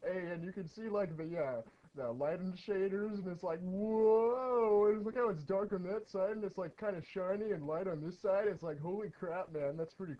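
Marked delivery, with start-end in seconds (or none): 3.6-4.9 slow, louder drawn-out whoa; amazed whoa. Real, not acted. Gamer amazed at the lighting, slow whoa. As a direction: stretch the whoa in awe.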